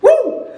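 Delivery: loud high whoop of victory. Acted. Voicing Nikki Cross after her win, loud mock whoop. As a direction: let out a shrill triumphant whoop.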